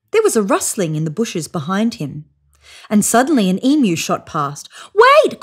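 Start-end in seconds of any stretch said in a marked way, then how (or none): 4.9-5.4 very high, loud shout; calling out. A narrator's reading. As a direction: shout after the running emu. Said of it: "called the bunyip".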